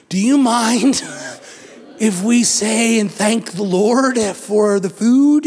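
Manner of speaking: slow, hesitant asking; timid family prayer request. Retold or imitated. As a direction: tiptoe into asking at dinner. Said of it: "And you're like".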